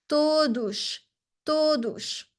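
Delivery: todos with the last S said like sh; final S as sh. Shown as a demonstration. Teacher models todos for students, ending in sh. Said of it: "The S in the end of the word is going to be a sh sound".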